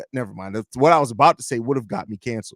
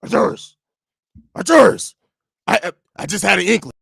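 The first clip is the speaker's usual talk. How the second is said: high, gravelly wrestler growl; Macho Man voice; 5 semitones higher, 4 dB louder than usual. Retold or imitated. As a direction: channel a wrestling promo. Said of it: "I went a little bit Macho Man there".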